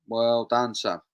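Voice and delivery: ordinary talk, nothing marked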